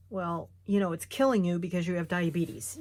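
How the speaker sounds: ordinary delivery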